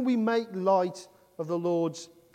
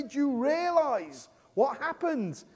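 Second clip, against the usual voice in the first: urgent cry out; 8 semitones higher, slower than usual. Retold or imitated. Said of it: "you want to sort of say".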